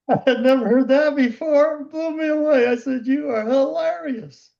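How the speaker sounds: high-pitched, delighted amazement; amused amazement. Retold or imitated. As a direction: retell it high, tickled and blown away. Amazed, amused at client's greeting.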